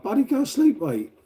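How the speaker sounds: mock exasperated call. Telling his friend to go to sleep, high mock exasperation. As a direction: pitch jumps way up on Buddy, then drops back.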